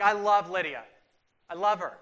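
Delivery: loud, gushing groom-to-be; lovestruck Doug. Retold or imitated. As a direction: proclaim it like a smitten fiancé.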